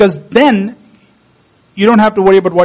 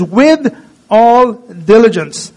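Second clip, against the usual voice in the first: slow solemn quote; much slower than usual. Retold or imitated. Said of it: "He says".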